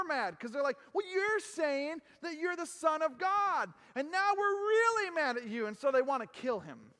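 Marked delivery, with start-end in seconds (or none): 0.9-5.7 mock outrage. Voicing angry crowd.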